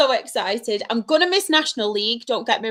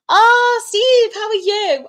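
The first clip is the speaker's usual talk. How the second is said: excited greeting; 11 semitones higher, 5 dB louder, slower than usual. Real, not acted.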